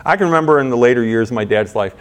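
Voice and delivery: ordinary talk, nothing marked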